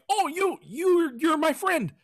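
high excited dog voice. Retold or imitated. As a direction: voice the happy dog squeaking. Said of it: "he sees the stick and he's like".

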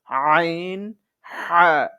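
pressed হা from the mid throat; throat-pressed ain. Shown as a demonstration. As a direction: squeeze the throat on আইন and হা. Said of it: "গলাকে চিপিয়ে". (গলা চিপিয়ে আইন)

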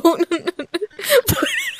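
breathy high giggle; high laugh. Real, not acted. Laughing at Kenny's antics, high giggle.

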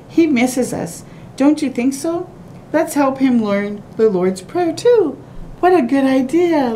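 ordinary delivery, nothing marked

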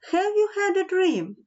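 high-pitched mother's question; storybook mother, pitched high; mother asking. A narrator's reading. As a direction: ask with high-voiced motherly concern. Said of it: "Mother asked".

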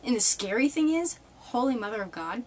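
usual speaking voice

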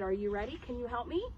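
ordinary delivery

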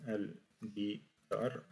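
"Al-guitar" said very slowly, every sound spelled out; slow demo pronunciation. Shown as a demonstration. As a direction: model "Al-guitar" slowly for learners. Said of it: "On l'appelle en langue arabe".

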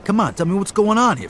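rising demand. Acted. Brother demanding his sister explain the thugs, heated. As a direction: push her to tell you, getting heated.

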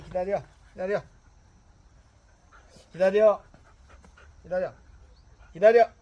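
loud, sharp wait command; firm dog commands. Real, not acted. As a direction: bark stay at the dog.

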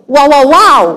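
hyped wow. Real, not acted. Mocking the crowd's muted response.

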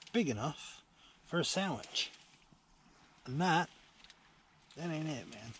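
ordinary talk, nothing marked

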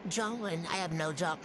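normal talking voice

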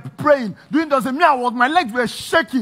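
high, very loud outburst; loud, worked-up testifying; impassioned shouted testimony. Acted. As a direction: shout over those calming you down. Shouting testimony over interruptions.

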